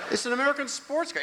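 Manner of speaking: high-pitched pleading; whiny high protest. Retold or imitated. Nephew whining to his aunt, high-pitched. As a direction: squeak it out defensively.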